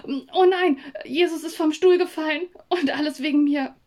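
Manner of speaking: höher und lauter, erschrocken herausplatzen; erschrockener Ausruf. Acted. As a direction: entsetzt losprusten, weil der Hund fällt. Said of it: "verschluckte mich vor Schreck und prustete los". (laut erschrocken, Kind am Weihnachtstisch)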